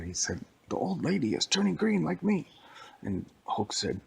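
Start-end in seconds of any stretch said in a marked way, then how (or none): 0.7-2.5 full sentence in a higher Shrek voice; proper Shrek talk. Acted. Shrek corrected to full sentences, higher voice. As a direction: speak in a whole sentence. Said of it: "Shrek doesn't talk like that".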